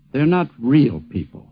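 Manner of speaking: ordinary talk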